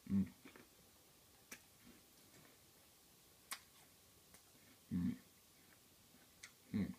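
chewing an apple; humming through a mouthful; mouth full. Real, not acted. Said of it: "sorry, I'm eating an apple".

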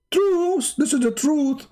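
high mock pious insistence; mock believer.

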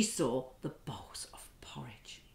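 whispered like sneaking in; hushed sneaky whisper; creeping whisper. Acted. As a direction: whisper so the bears won't hear. Creeping into the bears' house.

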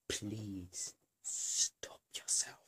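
very slow, drawn-out whisper; little inner voice. Acted. Inner voice warning a rambling vlogger, slow whisper. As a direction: whisper it slowly, like a voice inside the head. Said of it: "the little voice in my head always tells me".

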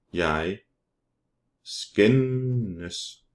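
"skændes" stretched long on the middle n; drawn out. Shown as a demonstration. Teacher models Danish verb for learners, drawn out. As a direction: say "Jeg skændes" slowly, holding the n in "skændes".

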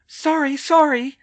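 high, loud, frantic apology; flustered Hermione, high and projected. A narrator's reading. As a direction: call it out high in a flustered woman's voice. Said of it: "she said frantically".

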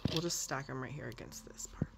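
soft whisper to herself. Real, not acted. Vlogger stacking toys against the shelf, whispering. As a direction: whisper while carefully stacking.

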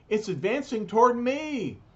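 high-pitched alarm; afraid. A narrator's reading. Khrushchev watches the tank come at him, afraid. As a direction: raise the pitch sharply in mock panic. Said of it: "Khrushchev is afraid again".

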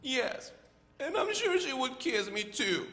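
high little-bird voice; high, drawn-out baby bird; Choco. Acted. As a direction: plead hopefully as a lonely chick. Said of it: "How about you be the voice of Choco?".

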